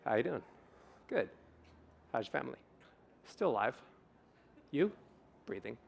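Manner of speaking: bored strangers trading flat replies; mock flat small talk. Imitating two people in line at the dry cleaners, deadpan. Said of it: "this is their emotional conversation".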